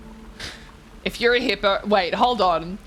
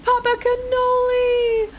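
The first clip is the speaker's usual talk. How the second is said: high, slow, drawn-out cry of the name; dramatic call; 3 dB louder, slower than usual. Pretend. Streamer calling out to viewer whose settings vanished, mock anguish.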